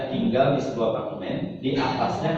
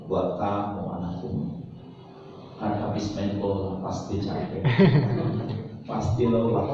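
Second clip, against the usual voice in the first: tender mother; 6 semitones lower than usual. Retold or imitated. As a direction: soothe him like a loving mum. Mother gently greets late son.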